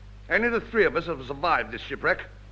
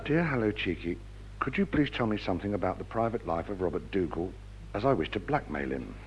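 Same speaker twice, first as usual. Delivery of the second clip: quiet; 7 semitones lower, 7 dB quieter than usual. Acted. Letter read in hushed voice.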